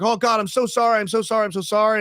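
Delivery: high, urgent repeated sorry; frantic apology. Retold or imitated. Father apologizing to the driver in a panic, high and urgent. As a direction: beg pardon of the startled driver. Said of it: "I'm looking at them like".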